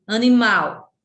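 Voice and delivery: Animal said loudly, weight on the final mal; emphatic demo of Animal, stressed on mal; stress on final syllable. Shown as a demonstration. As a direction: hit and hold the mal in Animal. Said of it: "Mal é a sílaba tônica".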